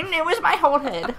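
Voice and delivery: high, cartoony skeleton voice. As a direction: squeak it out like a silly skeleton. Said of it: "That's such a skeleton voice".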